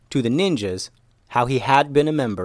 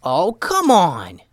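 high, loud, stretched-out protest; exasperated yell; 7 semitones higher, 5 dB louder, much slower than usual. A narrator's reading. Ninja splatted by a pie yells in protest. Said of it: "yelled Tatiana".

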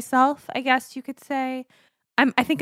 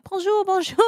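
high cute preschooler greeting; lightly voiced little French kids chirping; 10 semitones higher than usual. Mom imitates the preschool kids greeting cutely. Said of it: "They're all like".

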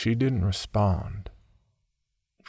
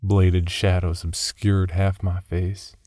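ordinary delivery

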